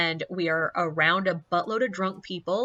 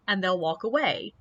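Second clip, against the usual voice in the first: normal talking voice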